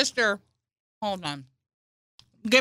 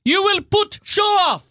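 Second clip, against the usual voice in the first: high, loud hammed-up parental yell; mock furious shout; 12 semitones higher, 4 dB louder than usual. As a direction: bellow it like a cartoon angry dad.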